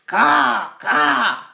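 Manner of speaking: loud, high crow cawing. Shown as a demonstration. Teacher showing children the crow's loud high caw. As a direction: call out like a crow. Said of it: "and he says".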